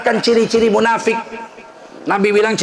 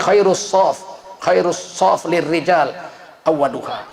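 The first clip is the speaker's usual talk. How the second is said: slow, lowered Arabic recitation of the hadith; 5 semitones lower, slower than usual. Acted. Preacher reciting a hadith to the congregation, slow and lowered. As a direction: intone the Arabic slowly.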